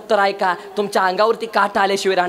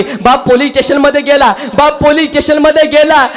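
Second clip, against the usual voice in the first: loud, driving kirtan storytelling; preaching at full force; 4 dB louder than usual. Acted. Preacher telling the crowd of a father's worry, loud and driving. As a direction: push the tale out to the whole hall.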